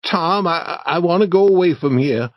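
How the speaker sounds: a touch of frightened stammer; faltering, scared boy wanting to leave; shaking voice. A narrator's reading. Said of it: "Huck said in a shaking voice".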